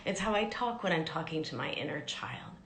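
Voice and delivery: ordinary talk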